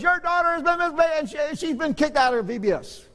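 high, fast mock officious voice; stern lady announcing. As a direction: parody the strict volunteer reporting to the pastor. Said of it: "she says".

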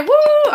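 high drawn-out "woo"; cheer. Real, not acted. Host cheering a contestant's right answer, high celebratory whoop.

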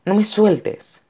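high, urgent plea; desperate plea. A narrator's reading. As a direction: beg your son to hold on. Mother pleading while being dragged away. (súplica aguda y urgente)

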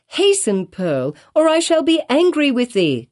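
high, urgent mother's call; a mother crying out to her child; cried out. A narrator's reading. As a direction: call her sharply to hurry. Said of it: "cried Hester Prynne".

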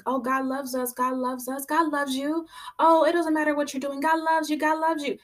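mocking, dismissive imitation of a shallow sermon; mocking quote. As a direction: parody the people who only say God loves you. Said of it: "People are using".

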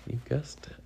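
hushed aside; quick whispered aside. Real, not acted. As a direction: breathe it out, unvoiced. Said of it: "aside to child".

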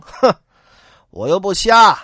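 loud scornful snort. Acted. Boatman snorting scornfully at the bandit brothers. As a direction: sneer it out. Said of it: "鼻子里一哼".

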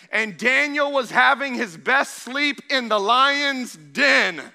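high, loud, driving sermon voice; full-force preaching. Acted. Pastor drives the Daniel story home to the church, full force. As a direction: preach it out to the whole room.

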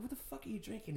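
high, hushed disbelief; baffled, keeping the volume down; incredulous retold question. Retold startled question to dad.

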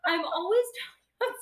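high, loud protest through laughter; laughing complaint. Real, not acted. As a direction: scold a friend while cracking up. Mock complaint to friend, cracking up.

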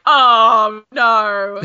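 loud, drawn-out wail of dismay; mock horror. Mock horror at women readers.

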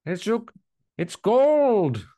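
high put-on Goldmember drawl. Joking about Dutch accents, hammed-up high Goldmember voice. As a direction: ham it up like Goldmember. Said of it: "trying to do Goldmember voice".

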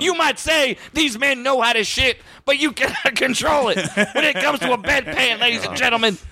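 wrestling commentator. Pretend. Commentator bit.

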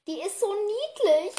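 high, slow coo; gushing over cuteness. Real, not acted. Podcaster adoring her cat on its back, gushing. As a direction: coo it, stretching the words in delight.

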